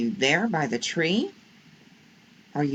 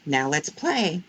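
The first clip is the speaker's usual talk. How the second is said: ordinary delivery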